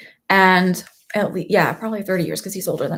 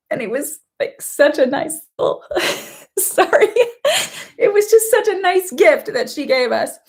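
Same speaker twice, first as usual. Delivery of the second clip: high, half-laughing sob through words; crying; 8 semitones higher than usual. Real, not acted. Remembering how grandma took them in, crying. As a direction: push the words out through tears.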